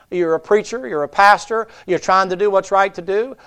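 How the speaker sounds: usual speaking voice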